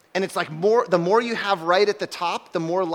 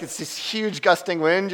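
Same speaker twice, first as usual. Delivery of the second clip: ordinary talk, nothing marked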